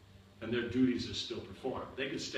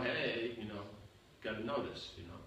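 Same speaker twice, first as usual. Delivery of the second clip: ordinary delivery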